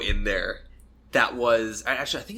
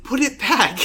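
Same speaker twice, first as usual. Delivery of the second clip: laughing mock urging; 13 semitones higher, 8 dB louder, slower than usual. Friends urging return of the toy.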